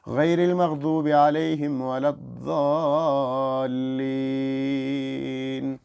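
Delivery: slow, calm, measured chant of the verse; slow Quranic recitation. Shown as a demonstration. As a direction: recite gently, word by word. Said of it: "ایسے آرام سے نماز پڑھیں". (آرام سے ٹھہر ٹھہر کر تلاوت)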